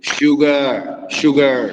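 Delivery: शुगर drawn out slowly in a hard Irish accent; hard Irish sugar. Shown as a demonstration. Teacher showing the Irish accent to students. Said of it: "ऐसे बोलते हैं".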